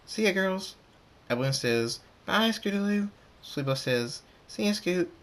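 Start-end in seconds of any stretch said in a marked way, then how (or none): 0.1-0.8 put-on high young voice; filly calling goodbye. A narrator's reading. Scootaloo leaving the girls, high goodbye call. 2.3-3.1 put-on high-pitched little girl pony; filly calling goodbye. A narrator's reading. Apple Bloom seeing Scootaloo off, high call. 4.5-5.1 lightly voiced young filly, higher; filly calling goodbye. A narrator's reading. Sweetie Belle seeing Scoot off, high little voice.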